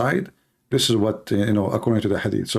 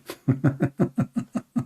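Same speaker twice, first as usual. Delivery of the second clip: long chuckling laugh; amused, drawn-out laughter; real laugh; 4 dB quieter than usual. Real, not acted. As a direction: chuckle in a run of short bursts. Laughs at his opponent's argument.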